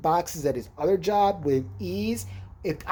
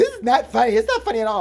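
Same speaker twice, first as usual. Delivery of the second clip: higher, louder mock protest; raised mock-indignant voice; imitated indignant friend; 5 semitones higher, 5 dB louder than usual. Imitates friend objecting to laughter.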